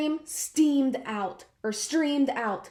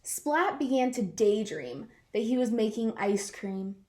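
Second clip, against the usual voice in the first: ordinary delivery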